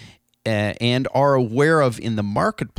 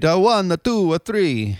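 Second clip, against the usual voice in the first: higher, louder counting vampire voice; Count from Sesame Street; 9 semitones higher, 4 dB louder than usual. Retold or imitated. Podcaster mimicking the Sesame Street Count while counting. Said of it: "the Count from Sesame Street".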